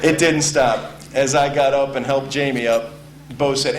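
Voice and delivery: normal talking voice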